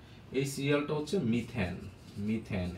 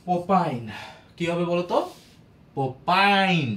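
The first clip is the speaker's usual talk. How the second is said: loud answer; 3 semitones higher, 7 dB louder, slower than usual. Shown as a demonstration. Asks class, then answers loudly.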